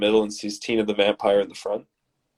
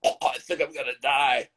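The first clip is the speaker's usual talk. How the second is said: higher mock-sick voice; retching; 4 semitones higher, 4 dB quieter than usual. A narrator's reading. Shot man vomiting on the ground, mock retching voice. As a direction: groan it out as if about to vomit. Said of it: "starts to vomit".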